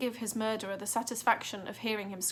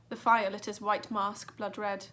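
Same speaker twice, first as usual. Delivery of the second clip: ordinary delivery, nothing marked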